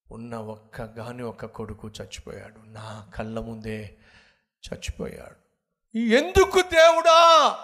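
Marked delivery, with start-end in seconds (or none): 6.0-7.6 much higher, very loud, slow wail; anguished cry to God. Retold or imitated. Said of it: "అని గట్టిగా అరిచాను". (దేవునికి ఆర్తనాదం)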